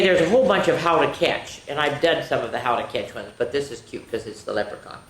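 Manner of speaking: ordinary delivery, nothing marked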